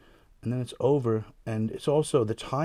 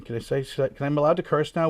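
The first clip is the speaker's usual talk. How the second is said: usual speaking voice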